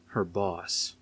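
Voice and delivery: ordinary delivery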